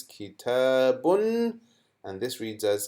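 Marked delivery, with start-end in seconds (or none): 0.0-1.6 slow, drawn-out reading aloud. Shown as a demonstration. Teacher reading the word out slowly for children. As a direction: sound out every syllable slowly. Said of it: "how would we read this".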